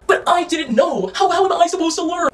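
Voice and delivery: high, slow, drawn-out mock plea; mock helpless excuse. Imitating men making excuses to her, mocking.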